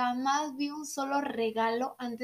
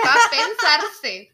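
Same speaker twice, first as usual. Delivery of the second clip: drawn out slowly, louder, laughing; slow laughing repeat; 2 semitones higher, 9 dB louder, much slower than usual. Shown as a demonstration. Friend savours the joke, slow and laughing. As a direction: stretch the punchline out through a laugh. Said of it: "repeats it slower, laughing".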